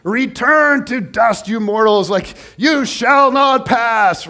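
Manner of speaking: Gandalf voice. Retold or imitated. Pastor playing Gandalf to the congregation, slow booming wizard voice. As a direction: boom it out like Gandalf at the bridge. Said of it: "the greatest Gandalf statement".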